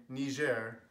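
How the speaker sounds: Niger as drawn-out nee-ZHAIR; French pronunciation. Retold or imitated. Imitating people on the news, French-style Niger. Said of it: "to pronounce it that way".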